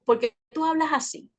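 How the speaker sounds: a hint of a child's questioning voice; daughter imitation. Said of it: "ella al principio me decía".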